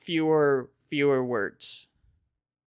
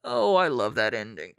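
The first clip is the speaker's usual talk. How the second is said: ordinary delivery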